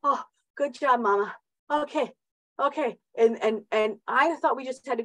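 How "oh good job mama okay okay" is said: lightly higher, mock cheering herself on; self-applause. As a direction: pat yourself on the back, a little higher. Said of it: "give myself this little applause".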